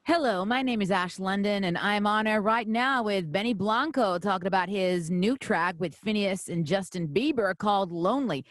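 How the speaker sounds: a light American accent in a smooth radio read. Shown as a demonstration. Host doing her American radio voice on request, light American accent. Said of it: "that's my American radio voice".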